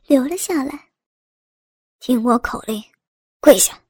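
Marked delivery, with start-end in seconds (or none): 2.0-3.8 low, curt barked commands; drill instructor. Acted. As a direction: order her like a drill sergeant. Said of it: "学着警察教官的口气命令道".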